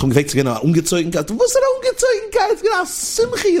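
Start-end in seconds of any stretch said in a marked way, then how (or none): 1.3-3.6 high-pitched incredulous retort; mock protest, voice leaping up; exclaimed objection. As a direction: throw the question back in a very high voice. Acted objection in a retold story.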